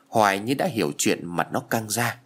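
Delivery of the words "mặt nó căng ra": ordinary delivery, nothing marked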